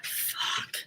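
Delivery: breathy whispered swear under the breath; whispered curse. Real, not acted. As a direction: hiss it to yourself so nobody hears. Muttered curse after mishap.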